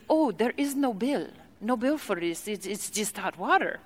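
soft, badly faked Congolese accent; mock Congolese accent. Tea lady waves away the bill, soft mock Congolese accent. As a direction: softly imitate the tea lady's Congolese accent. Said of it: "her accent sounded nothing like this".

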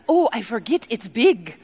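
roughly faked African accent; mock African accent. Tea lady answers the curious guest, mock African accent. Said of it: "how to do her accent".

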